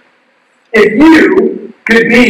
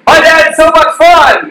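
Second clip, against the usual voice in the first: put-on higher, slow cheerful call of a proud kid; kid's happy shout; 8 semitones higher than usual. Son shouting to passersby in a mock cheerful shout. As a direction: shout it out like a delighted nine-year-old. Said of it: "he's shouting at everyone".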